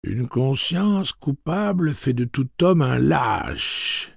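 high, slow and self-satisfied recitation; pleased-with-itself robot voice; smug, complacent. A narrator's reading. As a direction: savour the proverb as if you were being helpful. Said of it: "de sa voix complaisante".

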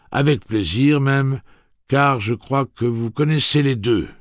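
high, loud, stately gunslinger speech; Roland's formal address. Acted. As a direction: announce it grandly to the whole crowd.

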